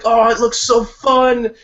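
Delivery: high, slow, loud gushing; excited gush. Retold or imitated. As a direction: gush with envy. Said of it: "and you're like".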